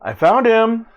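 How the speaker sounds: high, loud character voice; Kellis calling out. Acted. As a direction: call it out high to the others. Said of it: "you hear her go".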